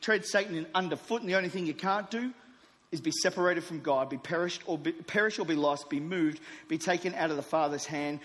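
loud, driving declarations of faith; preaching at full force. Acted. Preacher to the church, declaring with full force. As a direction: preach it out to the room, building.

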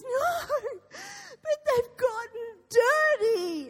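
high, loud tearful whine; mock child sobbing. Son objects to mother's plan, mock wailing. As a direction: wail the protest slowly like a little boy. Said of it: "he is sobbing it".

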